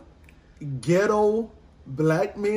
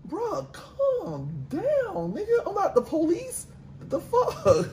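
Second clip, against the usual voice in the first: mock exasperated retort; 9 semitones higher, 5 dB quieter, slower than usual. Retorting to rude caller.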